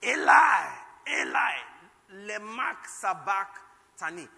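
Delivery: full-throated shout, slow at the end; shouted from the belly. Acted. Preacher shows Jesus shouting to God from the belly. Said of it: "He shouted it from the belly".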